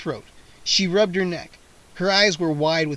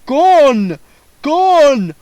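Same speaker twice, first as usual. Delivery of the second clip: loud wailing vampire, slowing at the end; 9 dB louder, much slower than usual. A narrator's reading. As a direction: sob out that they are gone. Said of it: "he cried".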